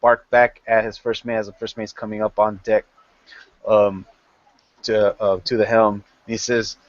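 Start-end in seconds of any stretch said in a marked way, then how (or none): none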